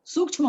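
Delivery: half chha joined to "ma" in sukshma; correct sukshma. Shown as a demonstration. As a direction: say sukshma as "sukchh-ma". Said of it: "होता है इसका उच्चारण".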